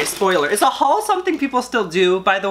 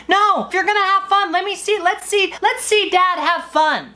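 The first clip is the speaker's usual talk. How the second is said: sarcastic wife; 10 semitones higher than usual. Acted. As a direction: needle him sarcastically.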